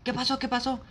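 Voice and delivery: high, frantic questions; hysterical mom. Retold or imitated. Mom woken at night, frantic and high. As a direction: ask it like a panicked mother. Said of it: "mi mamá, toda histérica".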